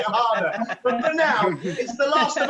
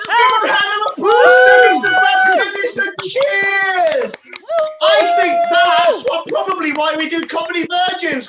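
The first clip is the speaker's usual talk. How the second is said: loud, higher hyped shouting; excited shout; 8 semitones higher, 8 dB louder than usual. Real, not acted. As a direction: pump up the crowd. Hyped compere after act.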